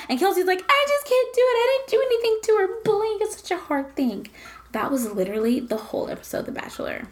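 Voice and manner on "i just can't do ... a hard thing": high, rushed weepy protest; hammed-up crybaby imitation, pitched way up; mock tearful whine. Friend imitating a contestant's defence to the bachelor, mock tearful whine. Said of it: "Kelsey started crying".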